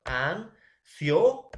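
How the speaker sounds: slow, loud, syllable by syllable "ansioso"; syllabified demo. Shown as a demonstration. Teacher spells out "ansioso" to students, slowly syllabified. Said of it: "acá sería, profesor".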